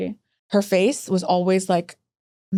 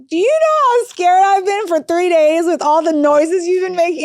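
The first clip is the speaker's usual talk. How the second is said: mock exasperated plea; 7 semitones higher, 5 dB louder than usual. Telling the surveyor off, with hammed-up exasperation. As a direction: overplay how scared you've been. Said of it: "I told her".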